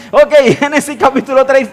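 booming restart after the joke. Real, not acted. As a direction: pull the room back to the scripture.

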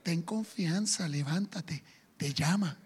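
mock sugary hypocrite; fake sweetness. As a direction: hypocritical soft coaxing. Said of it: "ahora le dicen".

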